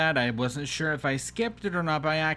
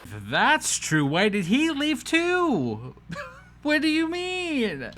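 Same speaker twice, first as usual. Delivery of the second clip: incredulous outburst; 10 semitones higher, 3 dB louder than usual. Real, not acted. Commentator reacting to his leaving too, incredulous. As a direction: wail it in exaggerated bafflement.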